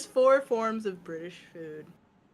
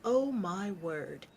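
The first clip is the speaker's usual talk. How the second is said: ordinary delivery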